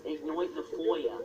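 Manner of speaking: mock British accent, FOYA with an oy; weird put-on accent. Shown as a demonstration. Correcting the British friend's take, weird mock accent. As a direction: correct them in a silly oy-vowelled accent. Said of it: "my weird accent".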